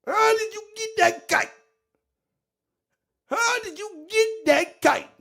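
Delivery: very high mimicking voice; Cornelius. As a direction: squeal the questions in disbelief. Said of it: "Like Cornelius said".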